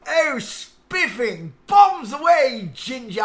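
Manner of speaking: very high, loud, drawn-out caricature English toff; mock posh English. Streamer parodying Americans' idea of English people, exaggerated posh English accent. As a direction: slowly whoop it in a hammed-up English accent. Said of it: "All Americans do that about English people".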